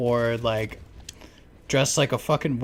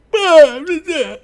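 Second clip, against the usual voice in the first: mock arguing gibberish; 11 dB louder, slower than usual. People arguing inside the house.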